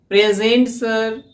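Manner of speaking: crisp, energetic roll-call reply; lively roll-call answer. Shown as a demonstration. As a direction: answer present sir firmly, stress on pray. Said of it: "ऐसे बोलना है".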